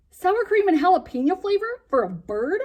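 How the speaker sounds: loud, high disbelief; can't believe the flavor; a boy staring at the cracker box in disbelief; incredulous. Acted.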